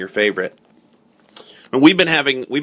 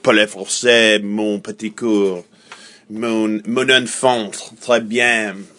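slow French accent demonstration; slower than usual. Shown as a demonstration. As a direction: show off a French accent phrase by phrase. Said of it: "that'd be like French".

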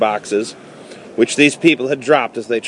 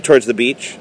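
ordinary delivery

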